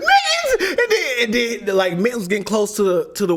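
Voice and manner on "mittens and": high laughing yell for the dog; hammed-up frantic shouting for the dog; mock panicked yell. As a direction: scream the dog's name in mock panic, pitched way up. Owner screaming for dog, laughing.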